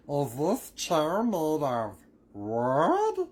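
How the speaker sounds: high, loud puppet voice, drawn out; Mister Woodchuck. Acted. Child showing off to parents after an episode, cartoon woodchuck voice. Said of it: "Mister Woodchuck".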